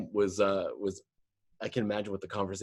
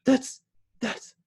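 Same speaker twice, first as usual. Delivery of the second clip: star-struck recognition. Retold or imitated. Star-struck subway rider, acted.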